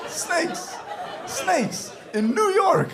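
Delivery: high, loud, laughing disbelief. Real, not acted. The husband repeats his wife's answer through laughter. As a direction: crack up saying it in disbelief.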